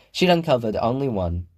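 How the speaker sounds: usual speaking voice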